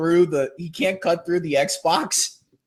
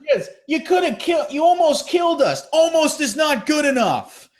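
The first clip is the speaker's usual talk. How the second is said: high, raised accusing tone; mock angry teen; indignant protest; 10 semitones higher than usual. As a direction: insist the near miss counts. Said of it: "quoting the kids".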